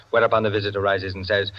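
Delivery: normal talking voice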